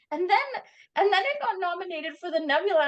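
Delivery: ordinary talk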